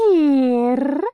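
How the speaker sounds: very high, strange voice for the madwoman Dirna; high eerie madwoman voice. A narrator's reading.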